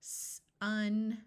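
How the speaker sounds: s and un pulled apart; slow segmented word; said slowly. Shown as a demonstration. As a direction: stretch it into separate sounds. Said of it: "I'm going to say a word slowly".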